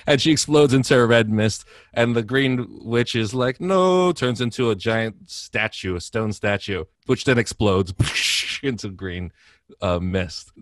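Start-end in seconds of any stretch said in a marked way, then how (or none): 3.6-4.1 anguished cry. Retold or imitated. Witch's last cry in the retold plot.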